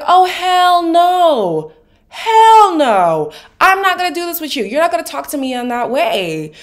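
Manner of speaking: lightly voiced sassy refusal; hint of Miriam's hell no; Miriam impression. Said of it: "Miriam was like".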